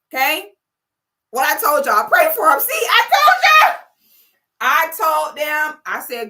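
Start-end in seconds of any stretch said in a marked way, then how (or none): 2.6-3.8 gleeful shout. Real, not acted. Gloating cry.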